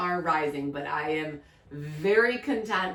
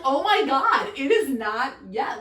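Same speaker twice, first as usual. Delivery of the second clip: mock realization; 6 semitones higher, 5 dB louder than usual. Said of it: "And I'm like".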